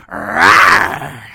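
a low, loud mock "Rawr" growl; hammed-up animal roar, pitched low; playful predator growl. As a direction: growl like a cheetah pouncing. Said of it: "that's what she's saying".

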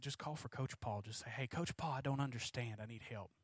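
softly spoken fatherly instruction; gentle coaching. Retold or imitated. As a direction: talk a child through it quietly. Father coaching daughter, retold.

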